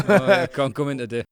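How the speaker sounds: a laughing put-on whine about not coming in; mock sick-day excuse. Fan mocking the manager's sick day, laughing put-on whine. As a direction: fake a feeble sickie voice while laughing. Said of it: "Now he's like".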